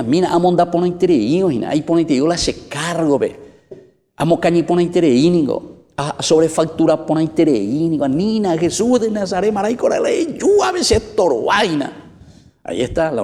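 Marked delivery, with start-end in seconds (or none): 0.0-11.9 possessed man voiced. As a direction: cry out his protest to Jesus, raised and pleading.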